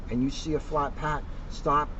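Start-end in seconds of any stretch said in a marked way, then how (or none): none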